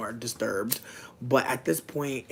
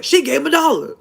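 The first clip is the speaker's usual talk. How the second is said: much higher, loud cartoon dad voice; high loud mock Chef Dad; imitating Chef Dad; 14 semitones higher, 13 dB louder than usual. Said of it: "Chef Dad was like".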